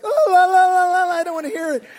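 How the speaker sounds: high, loud singsong refusal; la-la fingers-in-ears. Retold or imitated. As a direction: drown it out with a childish la-la. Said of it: "Brenda's like".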